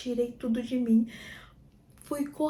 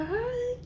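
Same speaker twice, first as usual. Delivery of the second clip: pained moan; 10 semitones higher than usual. Retold or imitated. Reliving a painful contraction.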